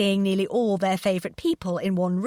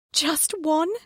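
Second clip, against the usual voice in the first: high, tearful plea; sobbed; 8 semitones higher than usual. A narrator's reading. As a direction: ask it through sobs. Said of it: "she sobbed".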